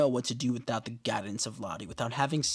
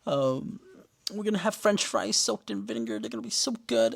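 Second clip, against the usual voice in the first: higher, quick, lightly voiced daydreaming girl; sweet dreamy girl; 6 semitones higher than usual. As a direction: coo to a pet mouse, a little higher. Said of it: "She's like".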